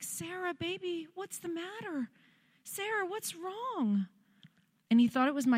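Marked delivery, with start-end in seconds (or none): 0.0-4.1 worried dad. Retold or imitated. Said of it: "I can hear the emotion in his voice".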